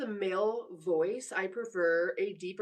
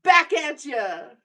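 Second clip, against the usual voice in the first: slow, much louder teasing throwback; playful loud retort; 6 semitones higher, 11 dB louder, slower than usual. Real, not acted. Teasing a viewer in chat, slow loud comeback. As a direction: toss it right back at them, slow and loud.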